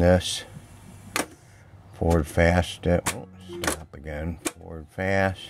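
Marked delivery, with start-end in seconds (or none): none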